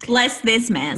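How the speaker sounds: loud, odd voice of a mock preacher; mock blessing. Blessing the man as a hammed-up preacher, loud.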